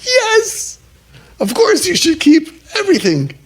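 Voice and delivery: mock the pushy advisor in a squeaky voice; mock eager answer. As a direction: parody the overeager rabbi. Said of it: "I knew I wasn't gonna say".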